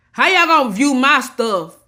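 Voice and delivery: exasperated outburst. Real, not acted. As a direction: hit it hard, fed up with the viewers. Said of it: "I'm getting everything out my chest today".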